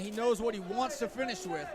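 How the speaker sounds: ordinary delivery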